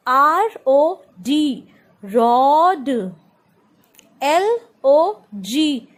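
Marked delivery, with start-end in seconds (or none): 2.0-2.9 rod with a long open 'aw'; sounded-out example. Shown as a demonstration. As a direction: stretch rod and release the d. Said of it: "It has to be O". (rod में खिंची हुई 'ऑ' की आवाज़)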